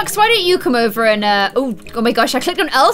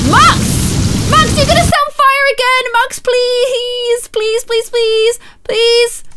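high begging cries; desperate pleading; 7 semitones higher, slower than usual. Real, not acted. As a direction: beg him to escape. Begging Max out of fire.

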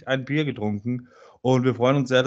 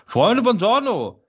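drawn-out, high and loud greeting; big show opener; 9 semitones higher, 5 dB louder, slower than usual. Acted. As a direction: boom out the welcome like a host. Podcast host opening greeting.

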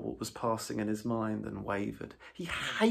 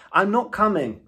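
much louder, higher, firm refusal; stubborn Rat; 7 semitones higher, 14 dB louder than usual. A narrator's reading. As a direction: dig in and refuse Toad outright.

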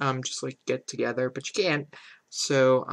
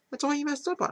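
much higher, exasperated whine; exasperated repeat; 12 semitones higher than usual. Real, not acted. Player scolding the stylist a second time, higher. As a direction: say it again, pushed higher.

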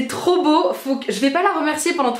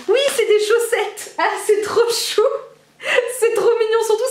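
delighted; 9 semitones higher than usual. Real, not acted. Discovering Christmas socks, high laughing delight. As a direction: squeal happily at the socks.

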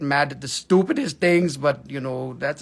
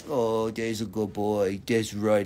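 lower, slow, soft praise; pet talk to dog; 10 semitones lower, 5 dB quieter, slower than usual. Real, not acted. Host praises his dog Doug on camera, tender and slow. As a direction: coo at the dog gently.

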